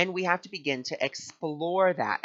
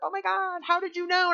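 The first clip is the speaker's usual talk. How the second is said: tearful amazement. Retold or imitated. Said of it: "she was in tears 'cause she was like".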